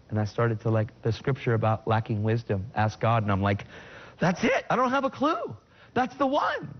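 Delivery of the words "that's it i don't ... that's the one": high, elated exclamation; thrilled, pitched way up; excited realization. Retold or imitated. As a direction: burst out as if you've just found the answer. Acting out his joyful realization.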